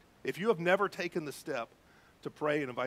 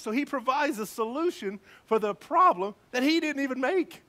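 higher, louder, fervent sermon voice building to a peak; full-force preaching; 8 semitones higher, 7 dB louder than usual. Acted. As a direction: preach it with rising passion to the congregation. Preaching the gospel message.

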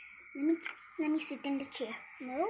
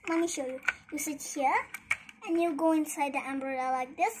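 normal talking voice

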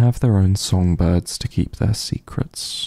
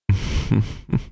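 amused chuckle; 3 dB quieter than usual. Acted. Teasing the lover in their lap, amused chuckle. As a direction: chuckle softly under your breath, indulgently.

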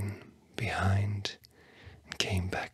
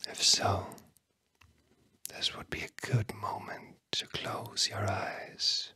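whispering. Real, not acted. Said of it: "words that I'm whispering to you".

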